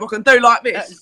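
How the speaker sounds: ordinary talk, nothing marked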